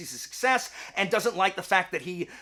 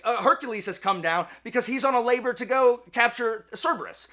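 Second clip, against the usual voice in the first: ordinary delivery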